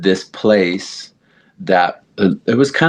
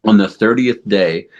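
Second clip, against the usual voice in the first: ordinary delivery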